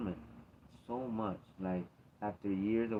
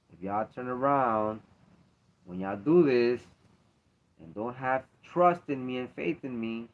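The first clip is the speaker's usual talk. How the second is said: stern loud warning; 3 semitones higher, 7 dB louder than usual. Acted. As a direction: build it into a heavy warning. God warning builds loud.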